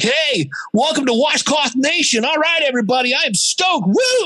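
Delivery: high, over-the-top excited shout-out; hyped podcast host. Acted. Host mocking overexcited podcasters greeting listeners, hammed-up hype. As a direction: parody a super-stoked show opener. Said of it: "hear the person that's like".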